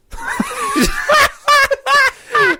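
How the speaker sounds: high, loud burst of laughter; real high cackling laugh; big laugh. Real, not acted. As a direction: crack up loudly. Laughs at own joke.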